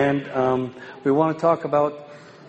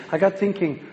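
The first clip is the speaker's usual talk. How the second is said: ordinary delivery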